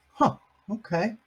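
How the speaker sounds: usual speaking voice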